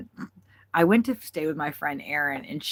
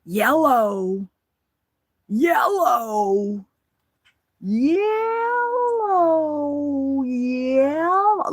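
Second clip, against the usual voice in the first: repeated sing-song yellow, louder and climbing higher; crazy style yellow; 10 semitones higher, 6 dB louder, much slower than usual. Retold or imitated. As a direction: keep chanting yellow wackier each time. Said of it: "doing it all crazy style".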